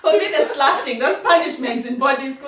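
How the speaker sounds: loud giggling memory; laughing loudly. Real, not acted. Laughing about boarding school slashing.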